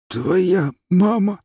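high, slow wheezing cry; wheezed through tears. Acted. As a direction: choke it out near tears. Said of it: "просипел дядя Миша со слезами".

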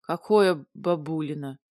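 low-pitched puzzled question; puzzled dragon. A narrator's reading. Dragon misunderstanding the heroine, low puzzlement. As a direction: ask it low, not understanding. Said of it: "Не поняли меня".

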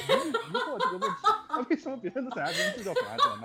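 high-pitched, laughing through the words; giggling incredulous question; laughing disbelief. Real, not acted. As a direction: laugh while asking, genuinely baffled. Baffled over song titles, laughing.

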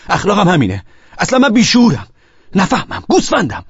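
high, loud bursts of self-insult; self-berating outburst. Acted. Husband berates himself after the call, self-berating outburst. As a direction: hurl each insult at yourself, pitch climbing.

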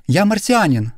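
put-on high bright young man's voice; proud, ringing announcement. A narrator's reading. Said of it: "произнёс он звонко".

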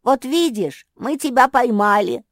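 very loud, high hurrah; jubilant cheer. A narrator's reading. Мафи jumping after catching Марсия, jubilant cheer. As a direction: cheer at full voice. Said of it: "запрыгала Мафи".

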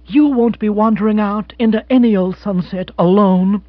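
sharp tart retort. Acted. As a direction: cut off his self-pity. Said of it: "a certain sharpness in her voice".

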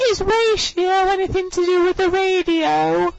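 very high squeaky mimic of a silly question; high mock-dumb question; mock Beverly. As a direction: squeak it like a clueless classmate. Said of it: "And then she goes".

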